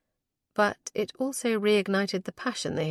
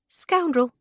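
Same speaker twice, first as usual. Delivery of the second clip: higher, louder playful jab; playful retort; 8 semitones higher, 4 dB louder than usual. A narrator's reading. Mary fires back at her husband, a higher, louder playful retort. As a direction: toss the insult back fondly. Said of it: "she countered".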